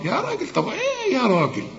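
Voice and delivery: high-pitched incredulous reproach; mock exasperation. Mock scolding of wrong pronunciation.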